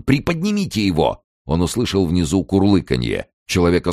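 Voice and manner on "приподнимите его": high-pitched urgent command; yelled order. A narrator's reading. Kern ordering the body lifted, urgent yell. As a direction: call down urgently to someone below. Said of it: "заорал он".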